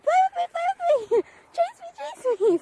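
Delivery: very high, loud excited puppy; voicing her puppy begging to play, very high and loud. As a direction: squeal like an eager puppy. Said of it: "my puppy brain is like".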